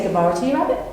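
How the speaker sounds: low, deep shark greeting. Acted. Shark replying to the rabbit in a low voice. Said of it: "And he said".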